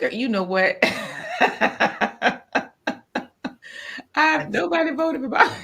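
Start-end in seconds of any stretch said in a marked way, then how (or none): 0.8-5.6 laughing. Real, not acted. Laughing over poll result.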